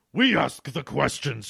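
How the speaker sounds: slow, mildly angry character voice; understated storybook anger. A narrator's reading. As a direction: put a touch of anger in each slow word. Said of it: "Tusra said angrily".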